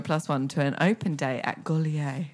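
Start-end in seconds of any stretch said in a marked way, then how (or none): 1.6-2.3 attempted French accent. Shown as a demonstration. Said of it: "sort of a French accent".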